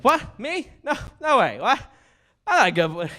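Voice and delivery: very high, astonished dog voice; high-pitched flattered pup; talking dog. Acted. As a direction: squeal like a bashful talking dog. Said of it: "my impersonation of a dog".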